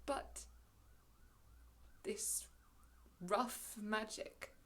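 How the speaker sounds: soft, halting, with long pauses; hushed renunciation. Acted. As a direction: weigh each word before giving it up. Prospero hesitates before renouncing magic.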